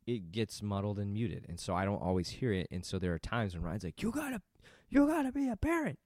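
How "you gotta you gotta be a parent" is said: very high-pitched mock wife voice; exaggerated nagging wife. Husband imitating how his wife sounds in his mind, squeaky mock nagging. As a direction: squawk it in a hammed-up falsetto. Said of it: "She doesn't say it like that".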